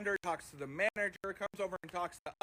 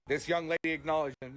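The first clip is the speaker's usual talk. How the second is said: usual speaking voice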